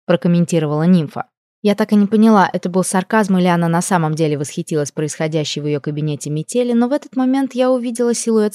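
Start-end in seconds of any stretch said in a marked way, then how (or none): none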